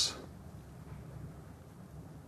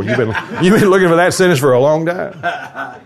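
higher-pitched, playful self-talk; mock self-congratulation; 7 semitones higher than usual. Preacher ribbing himself over his lucky line, higher mock self-congratulation. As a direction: tease yourself in a raised voice.

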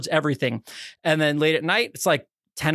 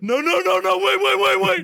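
very high, frantic string of protests; panicked pleading; 14 semitones higher, 3 dB louder than usual. Retold or imitated. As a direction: beg them to stop in hammed-up panic. Panicked refusal, acted out laughing.

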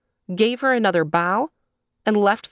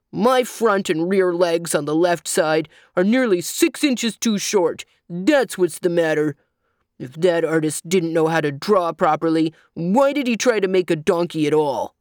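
gruff, indignant donkey voice; aggrieved angry donkey. A narrator's reading. Donkey explains his short legs to the child, aggrieved. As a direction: rant crossly as a lopsided donkey.

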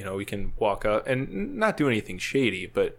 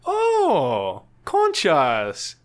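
high, loud drawn-out discovery; imitated delighted realization; 7 semitones higher, 7 dB louder, much slower than usual. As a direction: mimic a guard suddenly catching on. Guard recognizing the seashells, retold.

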